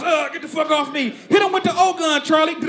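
high frantic yelling; fighting cops shout. Retold or imitated. Suspect brawling with cops, frantic high shouting. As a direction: holler at the cops mid-brawl.

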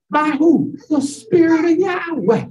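ordinary talk, nothing marked

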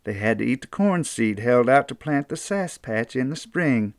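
plain reading voice

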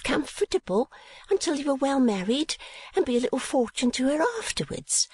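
high-voiced chattering portrait painter; Miss La Creevy. A narrator's reading. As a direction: lift into a high, prim, gossipy voice.

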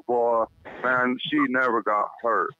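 ordinary talk

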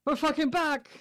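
hyped. Real, not acted. Gamer hyping the squad's return, high and excited. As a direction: announce the comeback, buzzing.

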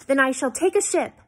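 high, loud defiant retort. A narrator's reading. Daughter defies father in quarrel.

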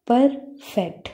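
परफेक्ट in two parts, "पर", pause, "फेक्ट"; split into syllables. Shown as a demonstration. Teacher showing pupils the two parts of a word, परफेक्ट split syllable by syllable. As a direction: break परफेक्ट clearly into two separate syllables. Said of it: "दो parts हैं".

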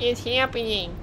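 mock the guy's squeaky voice. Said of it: "Did you hear this guy's squeaky voice".

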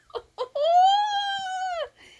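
high, loud laugh breaking into a sob; tearful laugh. Real, not acted. Thanking her cousin, laughing through tears. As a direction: laugh and cry at once.

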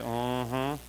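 low, subdued onlooker murmur; low-pitched mock suspicion; imitated staring onlookers. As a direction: play a watchful onlooker under your breath. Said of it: "everybody's looking at you like".

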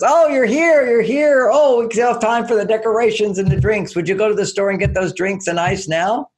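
delighted wife. Retold or imitated. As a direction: greet the late husband with bright happy relief. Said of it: "and she goes".

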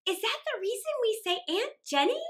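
put-on high, curious child's voice; little girl. A narrator's reading. Mary asks her father about the name, high and curious. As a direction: ask like an innocent small girl.

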